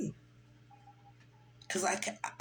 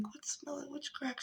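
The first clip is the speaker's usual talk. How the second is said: soft whisper, much quieter; whispered confusion; 5 semitones higher, 13 dB quieter than usual. Retold or imitated. Talking to her sister at the bar, whispering in confusion. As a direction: whisper it, baffled. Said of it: "I'm like".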